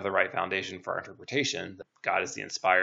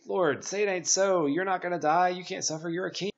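pleading disciple; 11 semitones higher than usual. Retold or imitated. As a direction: plead like a disciple who won't accept it. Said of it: "all the disciples and saying".

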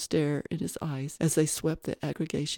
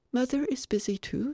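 put-on higher, earnest child voice; polite little boy; 8 semitones higher than usual. Little boy questioning the guest, politely puzzled. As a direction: speak as a small, courteous boy. Child answers about his mother.

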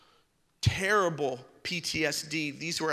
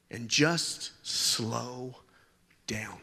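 slowing down; 6 semitones lower, much slower than usual. Acted. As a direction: let the words drag and die away. Preacher enacting slowing down.

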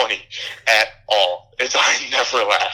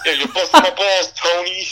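mock Italian-American; 8 semitones higher, 4 dB louder than usual. Mocking a film character's catchphrase in a hammed-up Italian-American voice. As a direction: play a cartoon Bronx wise guy, laughing.

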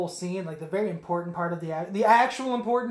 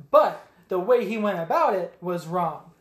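usual speaking voice

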